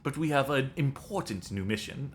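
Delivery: put-on hammy French accent reading a mission briefing; over-the-top French accent, fully committed. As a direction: play it like a French Resistance handler. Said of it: "This is my French accent".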